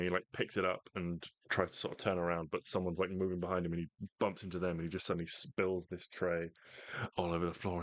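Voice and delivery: normal talking voice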